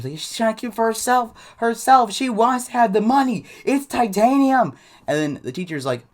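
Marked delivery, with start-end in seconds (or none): 0.2-4.7 put-on high, loud whining wail of a kid having a fit; mock tantrum. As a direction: imitate an inconsolable boy insisting loudly. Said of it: "you're having a fit".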